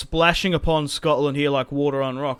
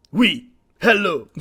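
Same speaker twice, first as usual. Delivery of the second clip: mock French; 3 semitones higher, 5 dB louder than usual. Voices the French troops.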